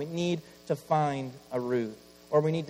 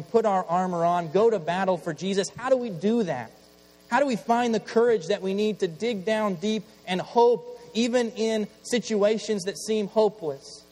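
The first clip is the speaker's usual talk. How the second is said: louder, higher rallying questions; fiery preaching; 7 semitones higher, 5 dB louder than usual. Acted. Pastor urges congregation to find hope, rising preaching. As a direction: build the questions up like a rallying call.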